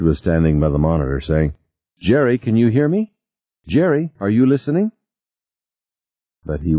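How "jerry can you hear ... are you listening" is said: raised calling voice. A narrator's reading. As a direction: call out to Jerry, urgently. Ted calling to Jerry on monitor.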